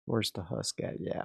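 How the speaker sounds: normal talking voice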